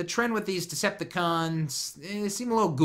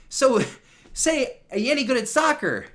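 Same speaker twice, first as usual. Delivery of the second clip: playful quiz; 11 semitones higher, 7 dB louder than usual. Real, not acted. Jokingly quizzing someone off-mic.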